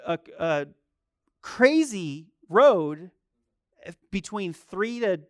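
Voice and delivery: ordinary talk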